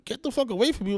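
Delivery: incredulous echo. Retold or imitated. Retelling his reply to the man who cursed him, incredulous. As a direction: throw his words back in disbelief. Echoes the insult back in disbelief.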